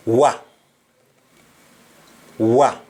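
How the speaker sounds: "wa" said as one open wah syllable; OI turned into a punched "wa"; Jamaican wa sound. Shown as a demonstration. Teacher showing learners the accent, Jamaican wa sound. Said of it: "O I becomes W A and the accent will be".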